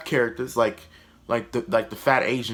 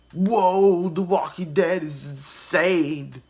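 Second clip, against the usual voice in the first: mock amazed viewers; 7 semitones higher, much slower than usual. Critic parodies viewers dazzled by added action, hammed-up amazement. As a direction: hype it up slowly like an easily impressed fan. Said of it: "we'd just be like".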